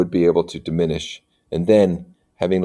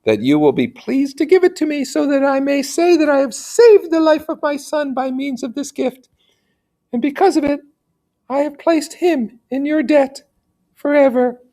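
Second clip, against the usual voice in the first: lady's pleading voice. Acted. Teacher voicing the lady begging Federico, high and pleading. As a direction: beg him in a high, genteel lady's voice.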